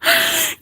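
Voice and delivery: high squealing wheeze of laughter; helpless laugh. Real, not acted. Streamer breaking down over a recorded scandal, helpless laughing. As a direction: laugh till only a squeal comes out. Cracking up at the gossip.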